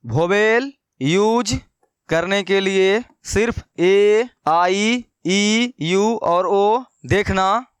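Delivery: slow, word-by-word dictation with repeats. Shown as a demonstration. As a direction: dictate slowly so students can copy it down. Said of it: "लिख लीजिएगा अपने copy में". (धीरे-धीरे लिखवाते हुए)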